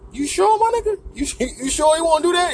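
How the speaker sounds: very high-pitched doubting buddy; mock falsetto disbelief; incredulous friend. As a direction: question it in a squeaky high put-on voice.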